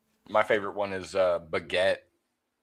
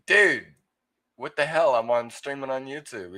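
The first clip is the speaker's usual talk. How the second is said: shocked outburst; 5 semitones higher, 4 dB louder than usual. Retold or imitated. Retells his shocked reaction to friend.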